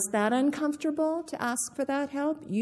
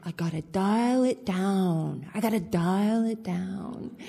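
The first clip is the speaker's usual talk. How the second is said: imitating a speaker. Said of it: "She was like".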